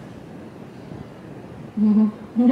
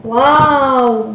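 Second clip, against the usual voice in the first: excited wow; 4 semitones higher, 6 dB louder than usual. Real, not acted. First taste of durian.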